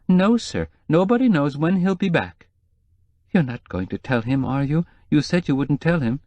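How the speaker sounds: lightly voiced worried housekeeper; nervous housekeeper.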